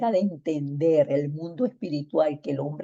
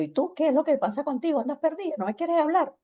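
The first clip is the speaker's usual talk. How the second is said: high, rapid-fire reproach; mock scolding, pitched way up; reproachful; 10 semitones higher, faster than usual. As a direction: pile up questions as if telling someone off. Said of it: "ella pensó que yo le estaba reclamando".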